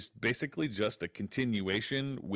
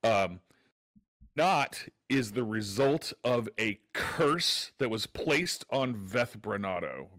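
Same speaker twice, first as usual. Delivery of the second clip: ordinary talk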